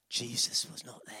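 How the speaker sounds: hushed unvoiced reveal; whisper. Telling children the tomb was empty, in a whisper. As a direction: breathe it like a secret.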